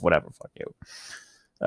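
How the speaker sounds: slow, whispered dismissive aside; whispered brush-off. Real, not acted. Vlogger whispers a dismissive retort to an imagined troll. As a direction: toss it off under your breath.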